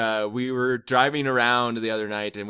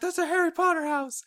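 very high, fast, lightly voiced little boy; mock excited kid; faster than usual. Dad imitating son's delight at the house, very high. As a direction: gush it like an excited son. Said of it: "He's like".

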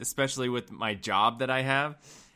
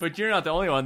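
normal talking voice

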